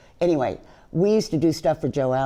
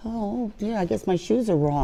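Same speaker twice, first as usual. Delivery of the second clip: high-pitched, hushed mock contrition; meek little one; 6 semitones higher than usual. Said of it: "I sat there like a little".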